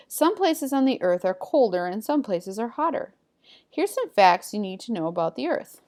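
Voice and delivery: lively, emphatic textbook reading; animated classroom read-aloud with lifted key words; reading aloud to pupils. Acted. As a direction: read the facts out clearly to a class. Reads textbook passage aloud to pupils.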